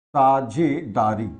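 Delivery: very slow, drawn-out term; slow dictation. Real, not acted. Teacher giving students the term for sharing, slow dictation. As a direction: spell it out slowly for the class.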